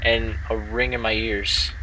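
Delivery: steady first-person story reading; story narration. Acted. Streamer reading the game story aloud to girlfriend, narrating. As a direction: read the game text as a tale.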